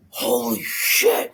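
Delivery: loud, drawn-out amazed swear; stunned exclamation. Retold or imitated. Man shocked at himself in the washroom, stunned exclamation. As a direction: stretch it out slowly and forcefully, stunned.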